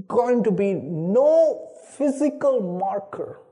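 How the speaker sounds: ordinary talk, nothing marked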